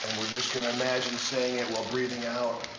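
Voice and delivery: soft, calm meditation voice; guided meditation. Acted. Leads a visualization.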